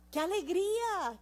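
high joyful cry of qué alegría; joyful exclaim. Real, not acted. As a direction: exclaim it with delight. Exclamación de gozo. (exclamación alegre)

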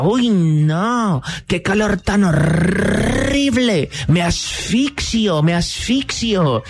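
high, slow, whiny voice of a woman fainting from the heat; complaining lady. Retold or imitated. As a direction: stretch out the whine like a lady melting in the heat. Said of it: "la señora decía".

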